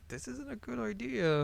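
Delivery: high-pitched timid objection; hesitant friend, imitated. Nervous friend objects to reading aloud.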